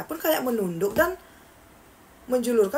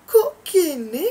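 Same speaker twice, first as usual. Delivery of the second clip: sudden high, loud startled question; startled gasp of a question; startled cry; 6 semitones higher, 7 dB louder, much slower than usual. Acted. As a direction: blurt it out in shock. Character's startled reaction quoted.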